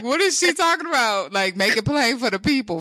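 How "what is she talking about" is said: puzzled. Retold or imitated. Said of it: "I'm like".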